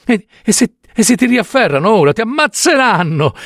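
stammering, high-pitched alarm; fearful. Acted. Wife dreading her escaped husband's recapture, fearful. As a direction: warn him in rising panic, stumbling at the start.

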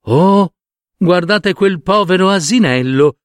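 a hint of a girl exclaiming; young woman. A narrator's reading. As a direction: raise the pitch a little for a village girl. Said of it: "disse una delle giovani".

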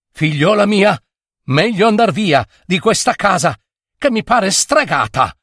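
high-pitched, worried plea; alarmed urging. A narrator's reading. Father urging daughter to leave bewitched house, alarmed.

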